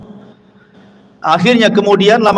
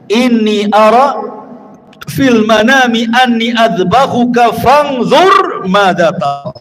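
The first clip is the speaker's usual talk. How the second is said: slow, drawn-out Quranic quotation; Arabic verse recitation; slower than usual. Acted. Preacher quotes Ibrahim's dream to the congregation, slow recitation. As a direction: recite it in Arabic with long held syllables.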